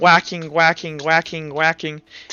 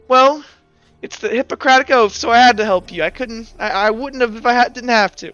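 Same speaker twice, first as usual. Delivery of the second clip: pissy, higher-pitched justification; huffy and stumbling, pitched up; Felix; 6 semitones higher than usual. Acted. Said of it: "pissy at you, and he goes".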